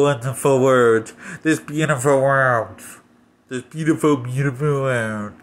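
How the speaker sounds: mock narration with a stuffy head cold; stuffy-nosed narrator impression. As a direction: imitate her breathy, accented voice-over like she has a runny nose. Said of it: "She speaks like this".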